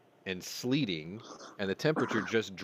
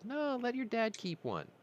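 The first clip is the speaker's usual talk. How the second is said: mom's voice. Retold or imitated. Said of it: "my mom says".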